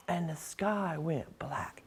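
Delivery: dramatic whisper; breathless whispered narration. A narrator's reading. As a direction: hush it like a sudden shadow. Dramatic turn in story.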